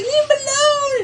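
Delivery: very high, imitated woman's scream; high-pitched retold shriek; mock screech. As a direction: screech it like someone breaking up a fight. Acting out woman jumping on him.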